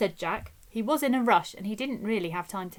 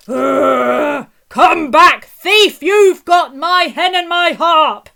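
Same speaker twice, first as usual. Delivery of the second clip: furious loud giant bellow, rising higher; giant shouting; 5 semitones higher, 14 dB louder, slower than usual. A narrator's reading. Giant chasing Jack who stole his hen and harp, bellowing. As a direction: roar after the thief like an angry giant. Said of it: "shouted the giant".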